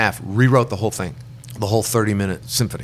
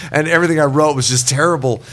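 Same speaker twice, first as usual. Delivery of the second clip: laughing; 6 semitones higher, 5 dB louder than usual. Real, not acted. Laughing at own failed writing.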